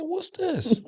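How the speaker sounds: high-pitched mock mom voice; imitating mom. Mom finding pictures in the book bag, imitated high. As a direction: question it high, as mom would. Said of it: "She said".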